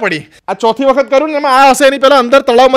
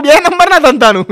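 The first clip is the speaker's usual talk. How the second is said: shocked echo, higher and louder; surprised; 6 semitones higher, 5 dB louder than usual. Retold or imitated. Narrator to friend, shocked echo of the words. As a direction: disbelief at the business. Surprised retort about shady business.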